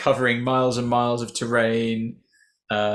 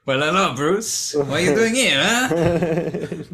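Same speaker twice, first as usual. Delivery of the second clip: higher, slow mock Mexican-ish accent; hammed-up foreign accent, drawn out; imitating the villain's accent; 6 semitones higher, 5 dB louder, slower than usual. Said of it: "What does that accent remind me of?".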